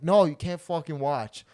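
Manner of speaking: loud, slow mock-angry refusal. Friend refusing his request, loud imitated annoyance.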